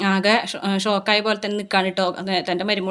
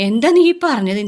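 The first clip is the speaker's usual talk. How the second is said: startled, higher and louder question; shocked mother; 6 semitones higher, 6 dB louder than usual. Retold or imitated. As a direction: blurt it out in sudden shock. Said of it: "Chandra ആകെ ഞെട്ടിത്തെരിക്കും".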